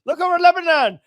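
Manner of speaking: high, hammed-up urging to look away; mock frantic distraction. Regime steering eyes to Lebanon, high mock urging. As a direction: steer their gaze off, mock urgent. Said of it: "We're like".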